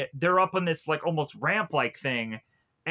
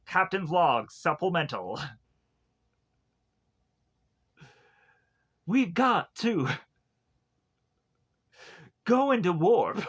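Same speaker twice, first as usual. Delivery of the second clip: Star Trek cast. Acted. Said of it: "becomes the entire cast of Star Trek".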